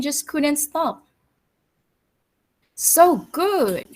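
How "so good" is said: a louder, higher "So good"; delighted declaration. Acted. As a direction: savour it and announce it to everyone. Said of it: "he declared".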